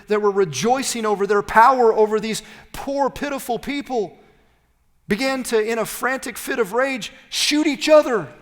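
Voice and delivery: loud, driving sermon climax, rising to a shout; preaching at full force. Acted. Preacher to congregation, loud and driving. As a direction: preach it hard and build.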